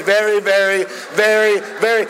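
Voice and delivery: each "very" driven high and separate; escalating emphatic very. Retold or imitated. Preacher goading his translator, "very" hammered high. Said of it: "and I said".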